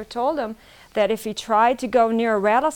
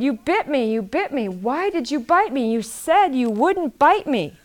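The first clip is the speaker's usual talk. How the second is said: higher, louder cry of accusation; upset little boy; 5 semitones higher, 6 dB louder than usual. A narrator's reading. Bitten boy confronts the snake that promised not to bite, raised, accusing voice. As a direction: protest in hurt disbelief, pitch jumping up.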